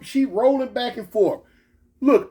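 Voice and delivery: ordinary talk, nothing marked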